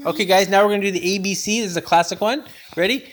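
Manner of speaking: excited intro. Real, not acted. Announcing the song to viewers.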